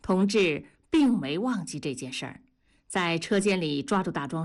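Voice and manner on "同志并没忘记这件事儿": plain reading voice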